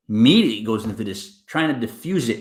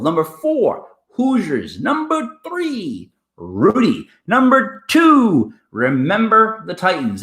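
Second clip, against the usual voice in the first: countdown announcer; 3 semitones higher than usual. Acted. Host counting down top ten sports movies, announcer style. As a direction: stretch out each number and title for suspense.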